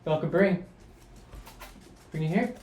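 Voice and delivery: high, coaxing dog talk; sing-song call to the pet. Real, not acted. Calling to the dog.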